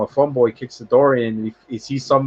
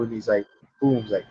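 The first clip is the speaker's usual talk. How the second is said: ordinary delivery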